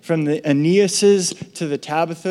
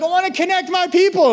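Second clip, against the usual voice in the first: voicing God; 13 semitones higher than usual.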